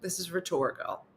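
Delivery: ordinary talk, nothing marked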